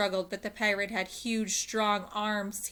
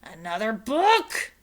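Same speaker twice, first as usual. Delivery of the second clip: loud slow disgusted roar; pirate captain roaring at another book; 3 semitones higher, 10 dB louder, slower than usual. A narrator's reading. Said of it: "roared Captain Bones".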